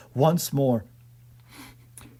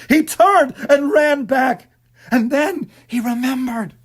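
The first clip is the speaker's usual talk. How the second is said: tense narration; 9 semitones higher, 5 dB louder than usual. A narrator's reading. Narration rising in panic.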